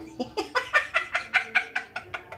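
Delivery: soft, high breathy giggle. Real, not acted.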